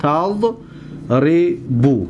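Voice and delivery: تضربو very slow, split as tad, ri-bu; syllable by syllable. Shown as a demonstration. Teacher asking students to watch closely, syllable by syllable. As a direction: pause long after tad in تضربو. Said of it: "دقت بتید".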